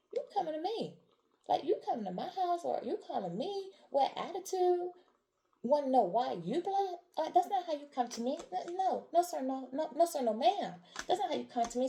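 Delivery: high-pitched, mock-indignant pushback; indignant comeback. Listener confronting the one who comes with attitude, indignant. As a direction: act out an affronted "not having it" reply.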